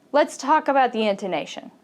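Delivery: normal talking voice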